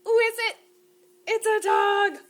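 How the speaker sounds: high, loud excited announcement; excited reveal. Acted. Librarian revealing the dog to the children, excited.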